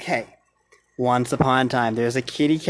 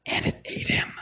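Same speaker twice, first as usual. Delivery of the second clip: whispered; 6 dB quieter than usual. A narrator's reading. Ending story whisper.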